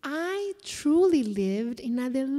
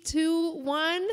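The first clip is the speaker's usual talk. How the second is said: usual speaking voice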